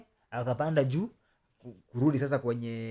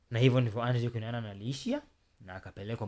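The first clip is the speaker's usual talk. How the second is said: ordinary talk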